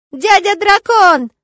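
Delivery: put-on high, loud, drawn-out child's cry; little boy thrilled to greet a guest; gleeful, long-held yell; joyful shout. A narrator's reading. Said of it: "радостно закричал он".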